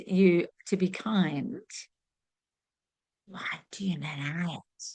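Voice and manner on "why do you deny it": soft unvoiced whisper; hushed challenge to the listener. Shown as a demonstration. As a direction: breathe the question right into their ear. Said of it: "I'll say it again".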